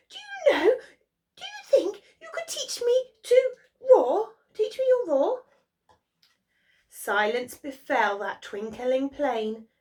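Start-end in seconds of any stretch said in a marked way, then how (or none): 0.1-5.4 high, nervous squeaky mouse; a tiny mouse trembling before a lion; high mouse voice, quivering and polite; timid mouse. Acted.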